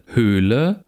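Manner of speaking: "Höhle" with a long close ö; long tense vowel. Shown as a demonstration. Lecturer reads minimal pairs, long tense vowel. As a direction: draw out the ö in "Höhle".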